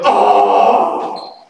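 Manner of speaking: breathless, unvoiced little gasp of a child playing along; child's mock gasp. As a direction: gasp like a child pretending to be shocked. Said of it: "the child plays along and goes".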